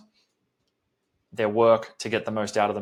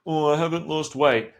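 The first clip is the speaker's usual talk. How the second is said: higher, louder mocking whine; whiny client; 5 semitones higher, 4 dB louder than usual. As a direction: mimic a client making excuses. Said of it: "Don't come to me and be like".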